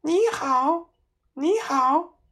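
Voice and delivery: high, squawking parrot greeting. A narrator's reading. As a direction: chirp it like a bird. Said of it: "鹦鹉在壁炉里面对他叫".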